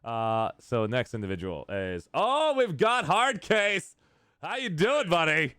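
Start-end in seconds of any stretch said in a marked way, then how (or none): 2.1-5.5 very high, drawn-out delighted welcome; excited greeting. Real, not acted. Streamer spotting an old friend arrive, thrilled greeting. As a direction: hail a long-lost buddy with a soaring voice.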